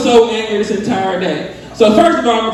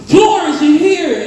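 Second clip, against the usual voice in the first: put-on higher, eager little boy; a lightly voiced little boy, pitched up; 8 semitones higher, slower than usual. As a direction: play the eager kid calling her name.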